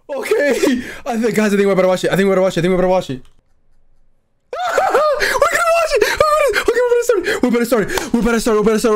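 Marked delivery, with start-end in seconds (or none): 4.5-5.1 high-pitched burst of laughter; excited laugh. Real, not acted. Thrilled as awaited moment starts. 5.2-7.3 high, giddy shout through laughter; excited squeal. Real, not acted. Thrilled as awaited moment starts.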